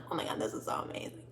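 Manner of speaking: fast, hushed excited whisper; rushed breathless inner squeal. Retold or imitated. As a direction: whisper it like a secret thrill. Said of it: "But inside, I'm like".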